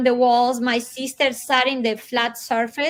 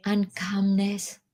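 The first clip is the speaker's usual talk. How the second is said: usual speaking voice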